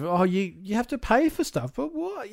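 rising into a high, baffled question; lightly voiced confused child; puzzled kid voice. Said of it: "The concept of".

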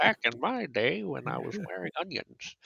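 put-on a laughing grumpy old man. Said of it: "to sound like an old man".

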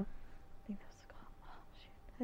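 whispered self-reminder, under breath; whispered aside. Real, not acted. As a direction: drop to a whisper to yourself. Forgetting a name, quiet aside.

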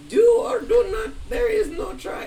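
badly attempted high Yoda impression. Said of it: "like Yoda said".

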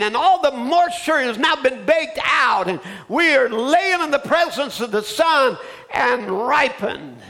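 ringing revival shout; fiery preaching. Acted. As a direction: bring it home to the congregation at full cry. Preaching at full force.

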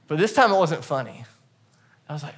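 normal talking voice

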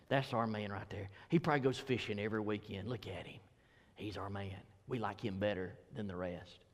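low, soft mock congregant gushing over Paul; lightly voiced fan of Paul, cooing his praise; church member voice. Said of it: "some Gentiles in the congregation that said".

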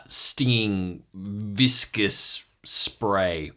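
usual speaking voice

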